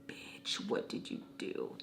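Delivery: barely voiced whisper; imagined inner thought. Imagining what she thinks in her brain, accusing whisper. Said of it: "in her brain, she's probably like".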